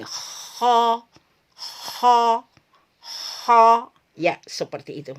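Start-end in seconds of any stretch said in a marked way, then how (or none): 0.0-3.6 Arabic ho with raised tongue back, full and thick; isti'la ho, tongue raised; heavy ho demo. Shown as a demonstration. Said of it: "Jadi terkumpul di sini".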